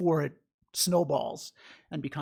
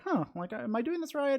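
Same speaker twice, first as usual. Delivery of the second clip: higher-pitched unsure self-questioning; mock puzzlement; 9 semitones higher than usual. Meditation student wondering if they're doing it right, mildly puzzled. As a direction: wonder aloud like a doubtful beginner. Voicing an unsure app user.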